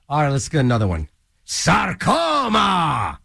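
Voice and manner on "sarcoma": drawn-out shout. Acted. DJ announcing the next song.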